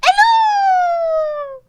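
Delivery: high, loud excited shout; booming giant hello. Acted. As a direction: call out big and cheery. Giant Starry greets the party.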